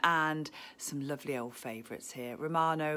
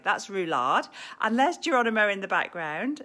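ordinary talk

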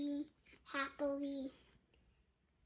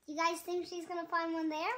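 plain reading voice

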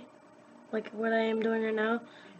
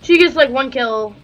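louder indignant griping; loud complaint; 2 semitones higher, 13 dB louder than usual. Real, not acted. Gamer complaining about teammate's points, loud. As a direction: complain loudly about the unfair score.

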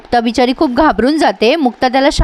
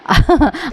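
short laugh; 3 semitones higher than usual. Real, not acted. Laughs at serial drama.

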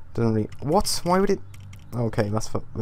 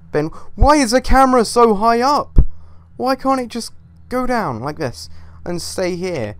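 much higher, louder complaining at the camera; exasperated rant; 8 semitones higher, 5 dB louder than usual. Real, not acted. Player ranting at the unruly game camera. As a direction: vent at the game, voice pitched up.

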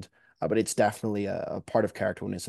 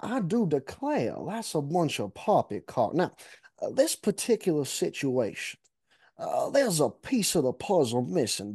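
exaggerated Southern accent; 6 semitones higher than usual. Shown as a demonstration. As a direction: ham up a dramatic Southern gentleman like Detective Blanc. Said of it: "can we hear a little bit?".

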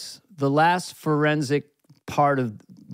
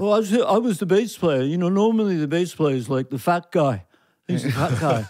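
humble Beatle impersonation; [name]; 2 semitones higher than usual. Acted. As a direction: put on a lilting Liverpool voice, modest and chatty. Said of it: "interviewing [name]".

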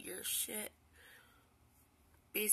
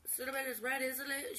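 higher-pitched exasperated retort; protest, baffled and raised; indignant disbelief; 5 semitones higher than usual. Retold or imitated. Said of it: "And I'm like".

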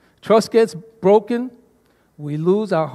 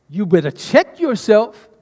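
slow, stern soldier warning; with attitude; 4 dB louder than usual. Retold or imitated. Drill leader warning a soldier, stern attitude. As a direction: talk down to a messing-up soldier. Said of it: "I have to say it with conviction and with attitude".

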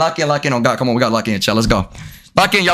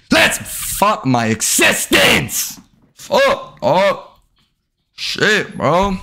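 slow, higher groaning shout at the game; drawn-out frustrated yell; 4 semitones higher, much slower than usual. Real, not acted. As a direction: stretch it out like losing hope. Frustrated gamer shouting at screen.

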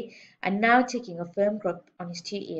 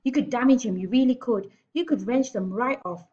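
higher, urgent pleading; begging; 5 semitones higher, 6 dB louder than usual. A narrator's reading. Miss Honey warns headmistress about a boy's ears, pleading. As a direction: press the warning in a raised voice. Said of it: "begged Miss Honey".

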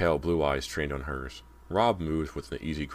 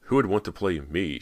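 higher, louder sarcastic question; 4 semitones higher, 5 dB louder than usual. A narrator's reading. Cody scoffing at herself to Rob, sarcastic. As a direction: toss it out with bitter sarcasm. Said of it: "she asked sarcastically".

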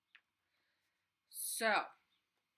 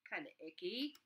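usual speaking voice

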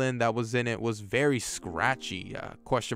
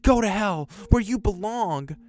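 shrill-pitched warped yelling, much higher; distorted shout; 6 semitones higher than usual. Acted. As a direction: yell it down at him like a demon in a grandma. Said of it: "Muriel shouted".

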